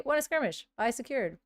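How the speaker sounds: normal talking voice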